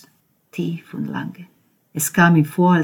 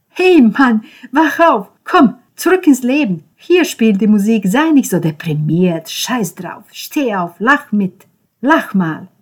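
high, loud, energetic; rousing shout; 6 semitones higher, 12 dB louder than usual. Acted. Ben rousing gloomy Konrad, high and loud. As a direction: shake him awake with your voice.

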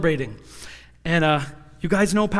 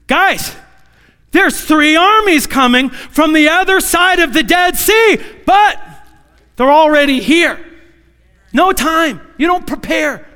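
urgent warning; 9 semitones higher, 7 dB louder than usual. Retold or imitated. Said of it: "He was really saying".

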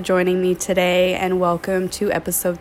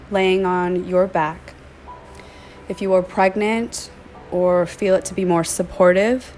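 soothing yoga nidra voice, phrase by phrase; guided meditation. Acted. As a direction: gently guide them into stillness, stretching key words. Leads a meditation.

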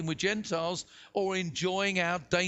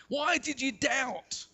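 chiding question; 6 semitones higher, slower than usual. A narrator's reading. Jesus chides Peter.

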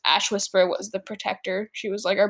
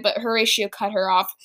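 plain reading voice

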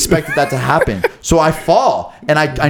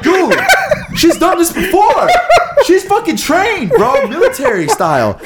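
very high, squeaky disbelief through laughter; incredulous, laughing. Real, not acted. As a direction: exclaim it way up high, cracking up.

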